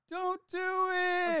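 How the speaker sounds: high, long-drawn mock holler; playful distant-sounding yell, stretched slow; retold yell. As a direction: call it out high and drawn out, like heckling a wedding. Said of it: "and I yell out".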